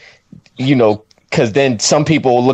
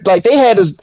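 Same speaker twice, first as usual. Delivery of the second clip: ordinary talk, nothing marked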